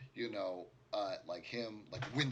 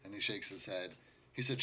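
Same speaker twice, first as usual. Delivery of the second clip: ordinary talk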